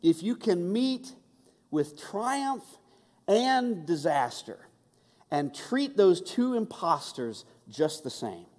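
slow, weighty reading of the line, each phrase set apart; slow emphatic recitation. Shown as a demonstration. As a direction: let every word land slowly, high on "triumph". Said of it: "here's the best line".

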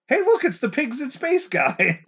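loud high excited shout. Real, not acted. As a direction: call it out with delight. Spots a character on screen.

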